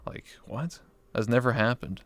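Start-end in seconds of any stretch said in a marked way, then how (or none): 0.1-0.8 breathy, baffled under-the-breath what; whispered incredulous aside; whispered disbelief. Real, not acted. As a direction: mutter it to yourself in disbelief. Baffled aside after retelling comment.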